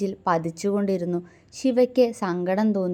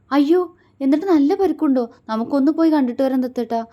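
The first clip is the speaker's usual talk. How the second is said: higher, louder, fast and tense; worried wife begging to visit; 6 semitones higher, 6 dB louder, faster than usual. Acted. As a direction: urge him anxiously to go now. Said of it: "ടെൻഷനോടെ പറഞ്ഞു".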